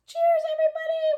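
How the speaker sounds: toasting the stream in a high voice. Real, not acted. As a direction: squeak out a cheerful toast. Toast to viewers, far higher voice.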